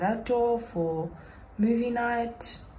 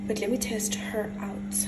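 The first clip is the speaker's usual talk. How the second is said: whispering. Real, not acted. Quiet aside while trying gadget.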